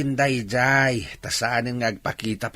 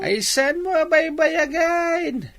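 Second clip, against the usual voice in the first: excited; 13 semitones higher, 7 dB louder, slower than usual. Acted. Father insists they marry.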